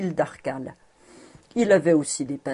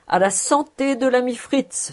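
high, lively toast; 6 semitones higher, 3 dB louder than usual. A narrator's reading. Schultz toasts his friend Fritz, calling out. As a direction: raise the glass and call it out. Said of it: "s'écria Schultz en levant son verre".

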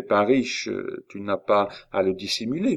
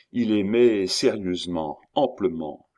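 ordinary delivery

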